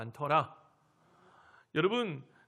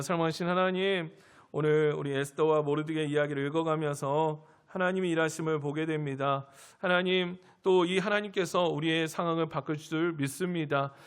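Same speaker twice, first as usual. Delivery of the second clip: earnest prayer, slightly louder and lower; public prayer; 3 semitones lower, 4 dB louder than usual. Real, not acted. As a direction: lead the congregation in fervent prayer. Leads closing prayer. (힘 있게 간구하는 대표 기도)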